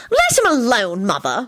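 tart rebuke, starting high; tart, falling scold. A narrator's reading. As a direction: tell off sharply, dropping on "Mother". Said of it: "Solange said tartly".

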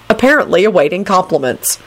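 usual speaking voice

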